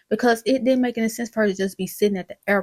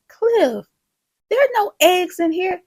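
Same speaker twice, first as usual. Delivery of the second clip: high-pitched, pointed complaint; exasperated wife; 9 semitones higher, 4 dB louder than usual. Retold or imitated. Wife finds no eggs in the bag, exasperated. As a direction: call out the forgetful husband.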